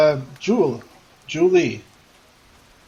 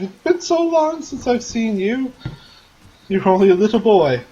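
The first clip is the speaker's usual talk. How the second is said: doting aunt; 8 semitones higher, 4 dB louder than usual. Acted. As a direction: gush over the grown-up nephew. Aunt fusses over nephew.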